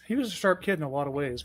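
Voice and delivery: usual speaking voice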